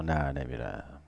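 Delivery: low, slow, soft flat refusal; stubborn refusal. Retold or imitated. Akbar Agha refusing his wife, low and slow. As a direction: dig in, drag the words. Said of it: "retold reply".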